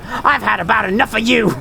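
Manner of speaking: put-on crazy old lady with a southern accent, pitched high; Marguerite. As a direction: do a crazed southern old woman. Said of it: "I sound like Marguerite from Resident Evil seven".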